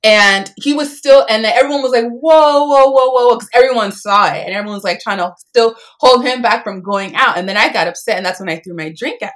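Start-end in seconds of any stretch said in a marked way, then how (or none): 2.0-3.4 loud, high crowd alarm; alarmed whoa. Retold or imitated. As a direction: call out to stop the fight. Crowd reacts to assault.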